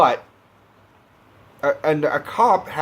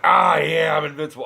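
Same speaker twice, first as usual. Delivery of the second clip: pumped-up shout; 4 semitones higher than usual. Pretend. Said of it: "I'd be like".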